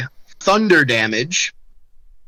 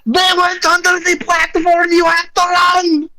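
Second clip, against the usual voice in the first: very high-pitched screamed warning; hammed-up panicked scream; 3 dB louder than usual. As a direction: shriek to make the others flee. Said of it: "[name] screams".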